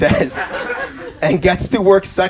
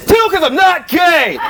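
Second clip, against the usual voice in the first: mock shout; 11 semitones higher, slower than usual. Real, not acted. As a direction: yell it slowly in disbelief. Mocking teen self's fixation.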